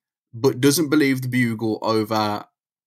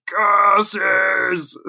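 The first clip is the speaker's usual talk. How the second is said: mock curse; 8 semitones higher, 3 dB louder than usual. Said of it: "both fists in the air".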